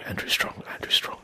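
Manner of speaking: whispering the name while getting into character; whispered warm-up. Shown as a demonstration. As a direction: murmur the name to yourself in a whisper. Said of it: "let's get into character".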